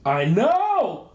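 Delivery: high, bright exclamation; excited. Real, not acted. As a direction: gush with excitement. Excited about Star Wars.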